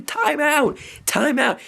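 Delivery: whole stadium calling for a timeout; mock crowd shout. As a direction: imitate the crowd chanting it. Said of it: "all the fans were, like, shouting".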